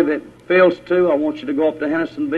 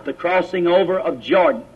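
normal talking voice

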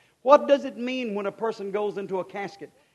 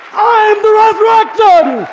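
much higher and louder, slow and drawn out; shouted preacher's climax; 18 dB louder, slower than usual. Shown as a demonstration. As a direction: belt it to the back row. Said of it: "repeated building".

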